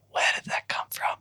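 hushed, breathy whisper to oneself; whispered amazement. Retold or imitated. Poet recalls reading her own writing back, in an amazed whisper. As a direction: whisper it as a private reaction.

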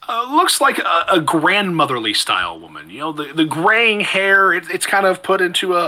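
ordinary talk

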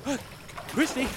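calling out high with worry; searching for her; anxious calling. Acted. Losing her in the water.

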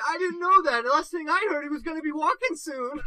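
high-pitched flustered defence; protesting innocence. Retold or imitated. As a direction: plead ignorance, about to crack up. Acted self-defence, on edge of laughter.

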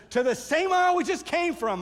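high, loud, exasperated retort; imitated upset yell. Upset worker answering the clueless helper, mock yell. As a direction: snap back like a fed-up worker, acted out. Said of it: "He's very upset".

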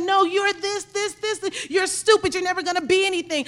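high, insistent belittling voice; acted put-down. People tell you you'll never be anything, acted put-down.

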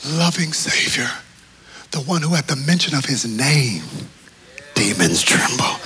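soft reverent hush; reverent whisper. Real, not acted. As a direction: breathe the words with awe. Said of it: "whispered reverent aside".

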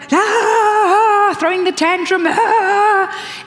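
high, loud whiny tantrum cry; mock tantrum. Preacher mimics a believer throwing a tantrum at God, high and loud. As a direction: wail like a toddler losing it.

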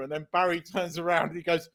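ordinary delivery

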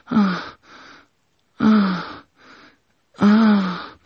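high, drawn-out moans; moaning. Acted. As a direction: moan in a high put-on voice. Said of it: "Faith starts moaning".

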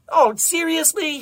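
high, loud protest; exasperated shout. A narrator's reading. Desmond cut short in the lab, shouting. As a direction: shout it in disbelief. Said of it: "he shouted".

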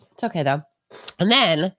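usual speaking voice

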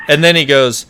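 usual speaking voice

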